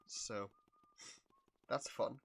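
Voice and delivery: normal talking voice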